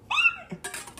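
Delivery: very high shocked laugh burst; mock shocked squeal. Playing a scandalised fan reacting to the confession, squeal.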